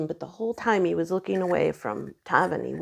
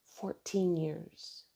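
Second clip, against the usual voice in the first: slow and much softer, letting it land; stunned slow; 10 dB quieter, much slower than usual. Real, not acted. Wife stunned by fourteen-year marriage ending, slow and soft. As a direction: barely say it, slowly.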